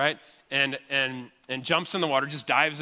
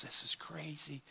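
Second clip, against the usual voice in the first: slow whispered aside; hushed awe; 15 dB quieter, slower than usual. Retold or imitated. Onlookers watch the lifeguard run out, awed whisper.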